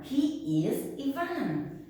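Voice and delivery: usual speaking voice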